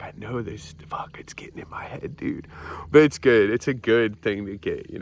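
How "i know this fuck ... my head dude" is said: hushed inner voice, breath only; whisper. Retold or imitated. AA lines stuck in his head, whispered to himself. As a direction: whisper it like a thought you can't shake. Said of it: "I'm like".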